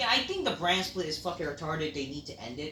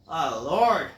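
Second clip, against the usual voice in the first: ordinary delivery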